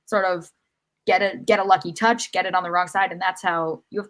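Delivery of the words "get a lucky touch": normal talking voice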